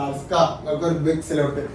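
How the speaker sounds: ordinary delivery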